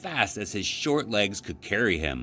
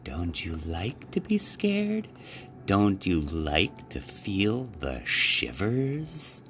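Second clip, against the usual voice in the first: spooky Frog; 4 dB quieter, slower than usual. A narrator's reading. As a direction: set a ghostly mood.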